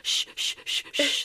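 whispered shushing. Retold or imitated. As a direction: hush them in a whisper. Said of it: "I'm trying to protect you guys".

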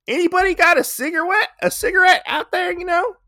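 squeaky high crying plea; comic high wail. Retold or imitated. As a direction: beg for a smoke in a whiny squeal. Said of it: "she's like".

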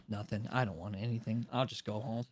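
low, soft giving-up mumble; mock defeat. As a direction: give up on being served, small-voiced. Acts giving up on ordering.

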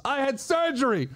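high-pitched comic wail; mock indignant protest. Defending himself to the nurse who thinks he's a wuss, mock indignant.

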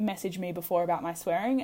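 ordinary talk, nothing marked

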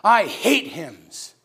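I hate hymns stretched very slow; slow drawn-out disdain. Retold or imitated. Preacher repeating the ladies' line to the congregation, slow. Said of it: "They said it just like that".

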